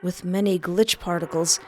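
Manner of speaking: plain reading voice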